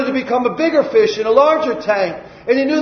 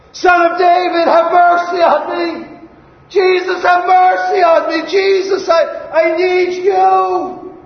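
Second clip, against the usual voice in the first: crying out; 7 semitones higher than usual. Acted. Blind beggar calls to Jesus for mercy, crying out.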